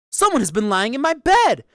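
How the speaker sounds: high, loud middle-sized bear. A narrator's reading. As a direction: cry out indignantly as the middle bear. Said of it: "in his middle-sized voice".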